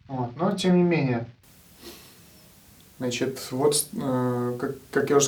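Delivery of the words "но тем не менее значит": ordinary talk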